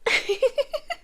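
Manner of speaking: light rising ghostly laugh; a distant haunted giggle; eerie far-off laugh. Acted. Eerie character greets listener.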